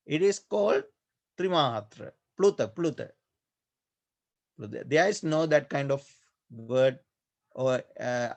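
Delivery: normal talking voice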